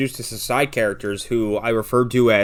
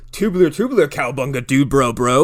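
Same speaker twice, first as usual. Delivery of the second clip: mock surfer dude; 2 semitones higher, 5 dB louder than usual. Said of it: "his communications basically".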